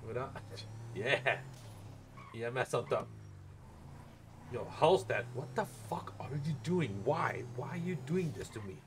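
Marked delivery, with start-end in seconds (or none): none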